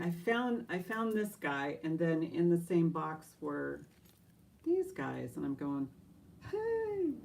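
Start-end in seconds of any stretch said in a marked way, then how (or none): 6.5-7.1 a sudden high squeal of delight; excited. Retold or imitated. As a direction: yelp like finding treasure. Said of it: "I was just got so excited".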